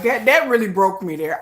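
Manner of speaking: ordinary delivery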